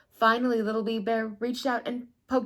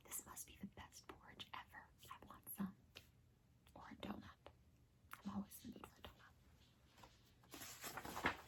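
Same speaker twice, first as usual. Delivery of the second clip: whisper; 27 dB quieter, faster than usual. Real, not acted. Whispered asides.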